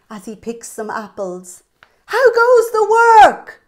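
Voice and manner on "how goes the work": high, loud, slow bossy call; lazy farmer calling out to the duck, high and loud. Acted. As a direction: call down lazily from bed.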